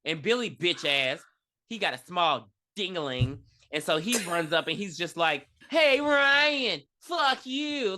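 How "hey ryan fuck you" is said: high-pitched mock bully calling out; hammed-up schoolyard taunt, voice pitched way up. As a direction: play a jeering kid bully.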